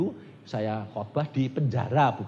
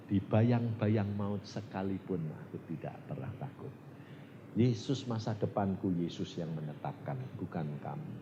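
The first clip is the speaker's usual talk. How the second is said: low, soft, calm delivery; quiet recitation; 5 semitones lower, 6 dB quieter than usual. Acted. Preacher recites song words of trust, low and soft. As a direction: recite softly, sinking lower and quieter.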